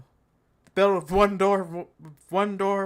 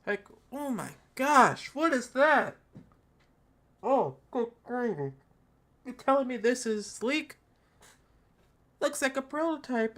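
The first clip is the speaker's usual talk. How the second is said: mock horror; 4 semitones higher than usual. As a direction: act scandalized by the camera bump. Mocking shock at the design.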